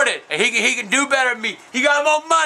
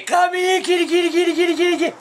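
high, sing-song kitty call; high-pitched coaxing for kitties; mock cat calling; 6 semitones higher than usual. Acts out calling like to cats.

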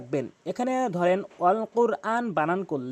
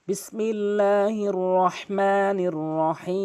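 slow, chanted Arabic recitation; Quran recitation; much slower than usual. Shown as a demonstration. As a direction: intone it slowly like tilawat. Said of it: "দুইটি আয়াত তেলাওয়াত করে নিচ্ছি".

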